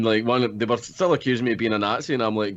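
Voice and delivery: ordinary delivery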